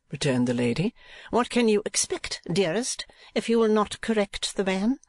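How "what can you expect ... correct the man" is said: high-pitched reproach; reproachful wife. A narrator's reading. Wife blaming husband over the footman, high reproachful voice. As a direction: pitch it well up as the complaining wife.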